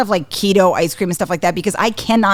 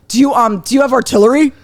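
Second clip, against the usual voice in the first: put-on higher, louder kid asking a question; eager nephew; 6 semitones higher, 5 dB louder than usual. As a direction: imitate an excited child's earnest question. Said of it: "And then he's like".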